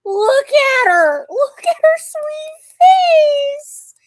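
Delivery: very high, drawn-out, loud squeal of adoration; squealed, slow pet-fawning voice. Real, not acted. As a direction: coo loudly at a beloved pet, stretching every word. Gushing over a sweet pet.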